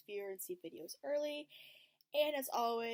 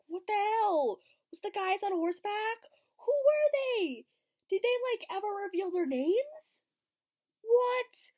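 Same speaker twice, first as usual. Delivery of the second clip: much higher and louder, slow at the peak; outraged yelling; 13 semitones higher, 9 dB louder than usual. Retold or imitated. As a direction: shout at the screen in disbelief. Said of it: "I'm like".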